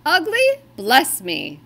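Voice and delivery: astonished Santa. Acted. Santa hears the reindeer call themselves ugly, loud astonished. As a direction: scoff at the very idea.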